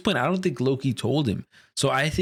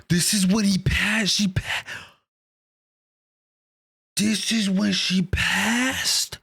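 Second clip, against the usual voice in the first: high, stunned cry of disbelief; gasp, then let the realization pour out high; shocked realization; 6 semitones higher, slower than usual. Real, not acted. Shocked reaction to death scene.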